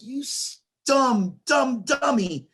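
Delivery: high, slowed taunting dumb dummy; mocking retort. Host retelling his reply to the announcer, slow high taunt. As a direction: draw out the insult like a playground jab. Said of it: "And I'm like".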